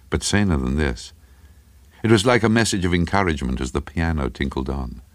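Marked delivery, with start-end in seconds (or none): none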